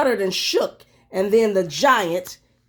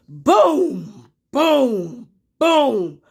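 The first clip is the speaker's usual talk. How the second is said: loud, drawn-out booms; booming footsteps; 3 semitones higher, 4 dB louder, much slower than usual. A narrator's reading. Narrator booming the giant's footsteps. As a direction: boom it out like heavy steps.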